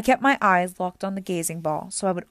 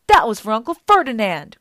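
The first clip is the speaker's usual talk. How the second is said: loud, higher, avenging shout; yelled retort; 4 semitones higher, 11 dB louder than usual. A narrator's reading. Grover avenges his uncle on Medusa, yelling back. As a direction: yell it back defiantly. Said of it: "Grover yelled back".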